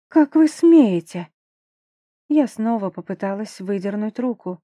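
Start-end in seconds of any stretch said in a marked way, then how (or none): 0.1-1.3 outraged. Acted. Heroine indignant at duke.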